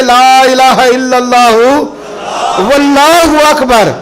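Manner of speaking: high, loud, drawn-out proclamation; Jibril. Retold or imitated. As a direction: proclaim the takbir slowly at full voice. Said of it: "تو حضرت جبریل بولے". (بلند، کھنچی ہوئی تکبیر)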